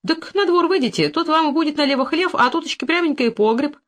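high, lilting chirp. A narrator's reading. Unsuspecting hostess directs guest to the cellar, chirping. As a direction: chirp the directions, pitched well up. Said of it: "защебетала".

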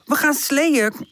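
high, stretched-out coaxing; insistent. A narrator's reading. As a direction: coax eagerly in a high voice. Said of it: "houdt Pip vol". (hoog, volhardend overhalen)

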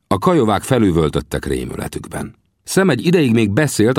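plain reading voice

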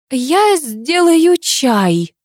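high, drawn-out flustered mum; high, slow mother. A narrator's reading. Mother announces tea and hurries off, high and drawn. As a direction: chirp it slowly while escaping.

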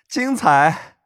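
high, mocking young man with a laugh; teasing laugh. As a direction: laugh and tease sweetly. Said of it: "白若瑶笑道".

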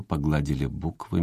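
plain reading voice

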